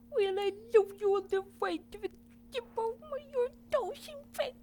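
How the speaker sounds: very high, fast squeaky voice; high-pitched cartoon squeak; squeaky character voice. Big bear voiced very high.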